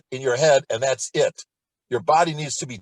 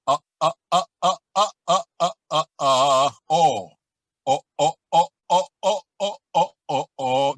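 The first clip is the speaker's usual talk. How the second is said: short louder uh uh onsets from the throat; glottal pulses; 4 dB louder than usual. Shown as a demonstration. Teacher demonstrating throat exercise, glottal uh pulses. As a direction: tick out uh uh with the vocal cords. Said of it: "Here we go".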